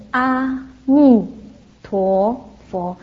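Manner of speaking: very slow, separated syllables; teaching syllable by syllable. Retold or imitated. Teaching the beggar child to repeat after him, slow and deliberate. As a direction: draw out each syllable for a learner to copy. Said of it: "我教你，你跟着我念".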